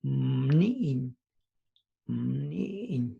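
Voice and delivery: Mni'in said starting with the lips closed tight. Shown as a demonstration. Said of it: "closing your lips like tight".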